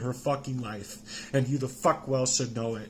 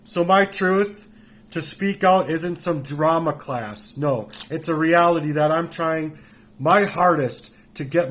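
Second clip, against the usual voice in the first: loud, heated insistence, slowing on the first words; angry raised voice; 3 semitones higher, 7 dB louder than usual. Real, not acted. Insisting his truth is no drama class, loud and angry. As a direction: push it out hard, still furious.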